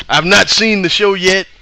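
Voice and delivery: normal talking voice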